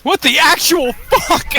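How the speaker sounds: high, loud disbelieving yell; shocked outburst. Real, not acted. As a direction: shriek it in total disbelief. Shocked at exploding cave.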